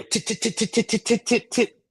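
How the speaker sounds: engine sputter. Retold or imitated. Imitating a failing engine start.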